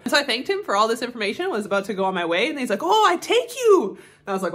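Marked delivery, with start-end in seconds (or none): 2.8-4.0 loud, high, drawn-out imitated Thai accent; mock Thai accent. Local man offering a tourist a ride, mock Thai accent. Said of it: "go into a bit of an accent".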